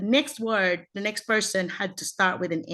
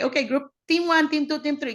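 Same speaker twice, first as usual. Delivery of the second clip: contest starter; 6 semitones higher than usual. Acted. Acts herself launching class contest.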